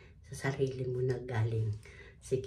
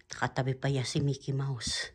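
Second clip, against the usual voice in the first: ordinary delivery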